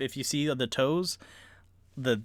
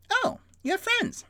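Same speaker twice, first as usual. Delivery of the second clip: much higher, delighted gnome voice; excited Tipsy; 4 dB louder than usual. Acted. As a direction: squeal with joy at new friends. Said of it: "Tipsy looks down and goes".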